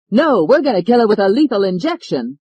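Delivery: high loud frantic yell; screaming. Acted. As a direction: scream it as you rush in. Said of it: "came running in screaming".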